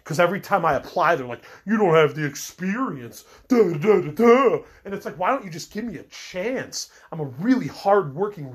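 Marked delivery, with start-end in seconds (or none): 1.6-4.6 loud, higher mock brush-off; mocking employers. Mocking job recruiters who reject him, loud and dismissive. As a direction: sneer it out, rattling off their excuse.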